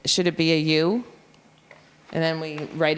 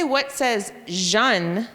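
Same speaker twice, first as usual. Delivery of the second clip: very slow, deliberate teacher prompt; slow drill question; 2 semitones higher, much slower than usual. Shown as a demonstration. Teacher quizzing the class on the jun sound, very slowly. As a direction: stretch every word for the kids. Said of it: "if you say".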